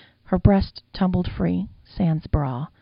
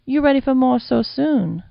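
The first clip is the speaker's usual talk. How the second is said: put-on higher, louder, teasing old madam; 8 semitones higher, 6 dB louder than usual. The madam teasing her eager lover, higher and louder. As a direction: tease him like a saucy old woman.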